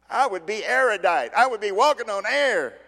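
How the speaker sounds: high, loud elated cry; joyful exclaim. Acted. Preacher imagining the forgiven man, loud elated. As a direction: burst with joy.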